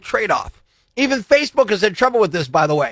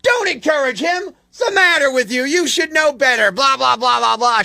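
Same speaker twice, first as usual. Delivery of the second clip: higher-pitched imitation of a telling-off; mock scolding mom; 5 semitones higher than usual. Host mimics mom ripping him over her kid, mock scolding. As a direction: rattle off a mom's rant, mockingly. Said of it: "ripped me for encouraging her kid".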